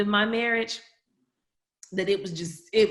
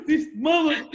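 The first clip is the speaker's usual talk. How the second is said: imitating Adam crying; 9 semitones higher, 5 dB louder than usual. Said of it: "I was crying like Adam at the end of Color Purple".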